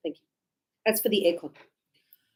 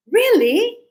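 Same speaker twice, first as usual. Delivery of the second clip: high, loud mock surprise; surprised really; 9 semitones higher, 7 dB louder than usual. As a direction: act amazed at the news. Teacher acts surprised reply.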